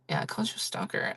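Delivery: quick whispered aside. Real, not acted. Streamer whispering a teasing aside to a chat viewer, fast whisper.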